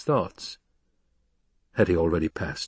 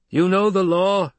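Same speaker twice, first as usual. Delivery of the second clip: high, raised objector's voice; challenger from above; 10 semitones higher, 5 dB louder than usual. Acted. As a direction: challenge the leader from a distance. Challenger argues from the rocks.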